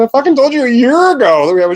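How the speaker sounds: high, loud, fed-up mock shout; imitating an exasperated yell; exasperated retort. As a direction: throw it back at her, exasperated. Retelling exasperated reply to daughter.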